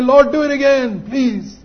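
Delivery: loud, high, urgent begging; impassioned retold prayer; fervent plea. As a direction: cry out as if clinging to his feet. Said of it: "clung to his feet and said".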